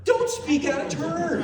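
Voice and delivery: high, loud proclamation of a rule; mock stern yell. Repeating his house rule for the crowd, mock stern yell. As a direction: belt out the rule in mock scolding. Calls out the rule after Dave.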